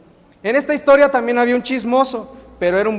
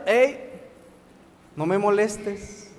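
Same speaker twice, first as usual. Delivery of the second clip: snooty brush-off; 6 dB quieter, much slower than usual. Acted. Donkey snubbing his mother.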